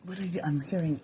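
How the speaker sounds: ordinary talk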